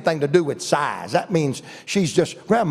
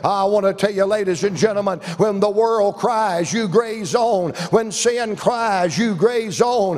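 full-force preaching; 5 semitones higher than usual. Real, not acted. Preacher proclaiming the Savior's voice to the congregation, impassioned. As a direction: drive each phrase upward to a climax.

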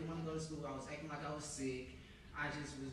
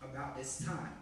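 firm, full-voiced Bible reading; scripture reading; 5 dB louder than usual. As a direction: read the passage out with weight. Reads scripture aloud to the congregation.

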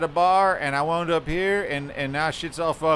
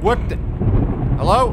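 nervous call; 4 semitones higher, 5 dB louder than usual. Real, not acted. Nervous calling into the dark.

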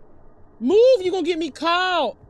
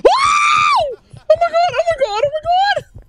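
high loud shrieks of fright; panicked shouting; scared screaming; 12 semitones higher, 4 dB louder than usual. Real, not acted. Jump scare.

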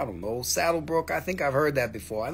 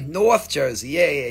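normal talking voice

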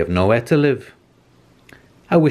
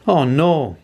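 dismayed cry; 6 semitones higher, 3 dB louder than usual. A narrator's reading. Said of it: "cried George".

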